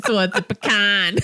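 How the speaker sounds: put-on teasing Michigan accent, "pecan" as puh-KAN; mock a Michigan flat a in "pecan"; exaggerated Michigan vowel on the last word. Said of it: "Brandy's from Michigan".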